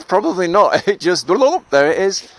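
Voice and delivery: amused and laughing, the second phrase slowed. Real, not acted. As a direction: let the laugh carry the words. Laughing at choosing anger.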